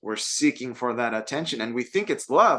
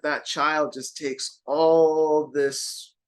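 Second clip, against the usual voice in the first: ordinary talk, nothing marked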